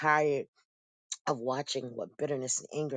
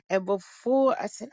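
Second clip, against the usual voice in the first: ordinary talk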